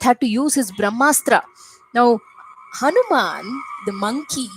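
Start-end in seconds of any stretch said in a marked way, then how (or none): none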